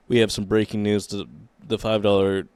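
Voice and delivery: normal talking voice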